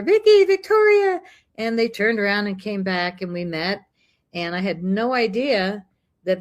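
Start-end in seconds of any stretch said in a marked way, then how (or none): 0.0-1.2 high, loud mock yell; imitated calling out. Shop lady calls friends back from the door, imitated yell. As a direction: call after friends leaving, pitched way up. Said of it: "she yells out the door".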